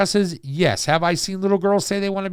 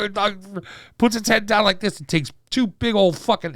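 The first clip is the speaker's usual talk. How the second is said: normal talking voice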